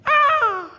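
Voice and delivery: high laughing scream, like on the drop; roller coaster scream. Retold or imitated. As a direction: shriek and laugh as the coaster plunges. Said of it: "I screamed".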